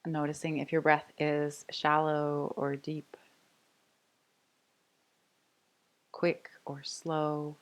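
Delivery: soft, unhurried meditation voice with long pauses; guided meditation. Real, not acted. Yoga teacher inviting the class to rest, calm guided meditation. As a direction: settle them gently into noticing the breath.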